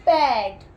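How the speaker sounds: पैड said loud and firm; emphatic answer. Shown as a demonstration. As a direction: announce पैड clearly to the class. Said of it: "P A D". (पैड ज़ोर से साफ़ बोलना)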